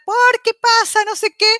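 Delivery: high, loud hammed-up shouting; mock yelling. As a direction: act out starting a row at full pitch. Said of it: "empecé a gritar".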